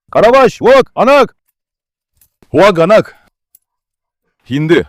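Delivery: loud, urgent command to stop; urgent shout. Real, not acted. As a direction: yell to break up a dogfight. Stopping dogs from fighting. (sigaw na pagpigil)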